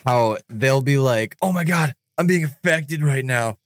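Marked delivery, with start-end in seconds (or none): 1.4-3.6 higher-pitched mock alarm; over-exaggerated panic. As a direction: overplay it like a ghost-show host. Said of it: "way, way over exaggerating it".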